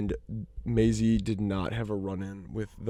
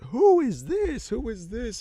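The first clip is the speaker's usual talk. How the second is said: high, slow, gushing nurse voice; excited nurse; 12 semitones higher, slower than usual. Retold or imitated. Nurse fussing over the patient's son, high excited coo. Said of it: "And she goes".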